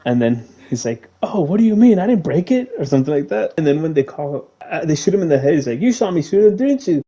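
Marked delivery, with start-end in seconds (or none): 1.2-2.7 high-pitched retold denial; mock protest. Paulie denying he broke the remote, mock protest. 5.8-7.0 high-pitched retold question; mock insistence. Man checking that his shot was seen, mock insistence. Acted quote from shooting scene.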